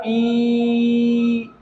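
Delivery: ई held long on a level note. Shown as a demonstration. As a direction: stretch ई to model the sound. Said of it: "का उच्चारण".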